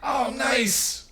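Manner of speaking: delighted reaction. Acted. Receiving a jacket handed over, delighted. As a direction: lift it high, pleasantly surprised. First take, corrected by second.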